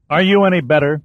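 a stung villain throws the accusation back; snapping back. A narrator's reading. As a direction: snap the question right back at him. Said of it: "Glei snapped back".

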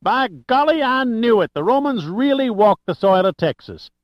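high, loud, thrilled triumph; excited. A narrator's reading. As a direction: gush it out, vindicated at last. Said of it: "He was so excited".

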